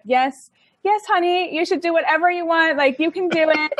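a hint of a cheerful mom cheering her on; encouraging mom. Retelling her mom's reassurance, lightly voiced encouraging tone.

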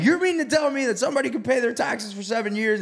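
high-pitched retold disbelief; incredulous. Retold disbelief at attorney's answer.

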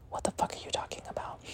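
barely audible, voiceless whisper; whispered disbelief. Retold or imitated. Stunned reaction to client's claim, retold.

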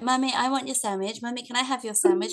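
put-on high, fast nagging little kid; pestering child voice. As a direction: whine for the sandwich over and over. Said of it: "he's like".

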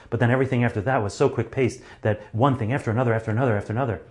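fast, rushing delivery; picking up pace. Shown as a demonstration. Speaker demonstrating a sped-up pace, fast. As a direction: rush through it like time flying by. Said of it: "I started picking up the pace".

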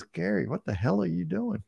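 a retold, startled "what are you doing"; baffled coworker. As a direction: act out the confused coworker. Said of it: "and the guy says".

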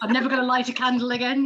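loud mock ultimatum to the cosmos; mock threat. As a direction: threaten the universe as if it could be scared. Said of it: "like the universe is terrified of me".

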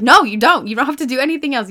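a sudden loud no to the doubting brain; firm no. Acted. Speaker to her own doubting brain, loud and firm. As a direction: shut the doubt down hard and loud.

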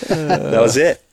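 laughing through the words; shocked laughter. Real, not acted. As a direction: chuckle while bragging. Laughing after spanking joke.

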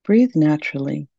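ordinary delivery

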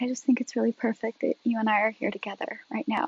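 very soft, gentle retelling; soft serene quote. Retold or imitated. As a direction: say it softly, as if under the stars. Retelling calm woman in film.

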